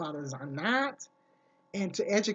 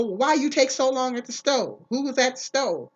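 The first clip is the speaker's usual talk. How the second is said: higher, louder demanding questions; mock interrogation; 6 semitones higher, 6 dB louder than usual. Controlling man questions her after the store, mock demanding. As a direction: imitate a partner grilling you for answers.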